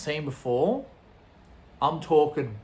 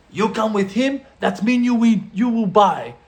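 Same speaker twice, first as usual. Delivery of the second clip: loud, high impression of a demanding dealer; imitated angry yell; 7 semitones higher, 9 dB louder than usual. Dealer pressing a reluctant visitor to buy, imitated yelling. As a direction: yell it as a foreign drug boss laying down terms. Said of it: "he yells it at me".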